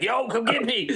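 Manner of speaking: high-pitched pretend call-out; mock call for help. Player imagines Mitch calling for backup, mock call for help. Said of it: "he needs to be like".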